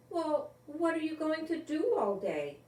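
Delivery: a mild worried question; mother asking. A narrator's reading. Mother questioning Bobby about his summer plans. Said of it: "Missus Quinn asked".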